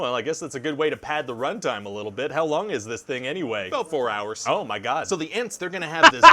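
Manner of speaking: usual speaking voice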